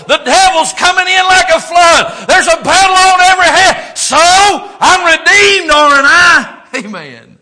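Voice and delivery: loud, high defiant shouting of "So?"; full-force preaching. Acted. Pastor defying trials before the congregation, shouted preaching. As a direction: shout back at every trouble.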